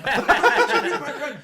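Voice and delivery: high, loud laughing mock yell. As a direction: blow up the repeat, laughing as you shout. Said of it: "I'm like".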